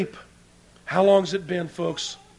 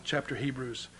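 ordinary talk, nothing marked

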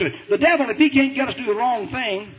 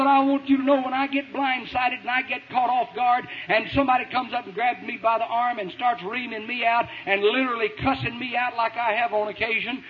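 ordinary delivery

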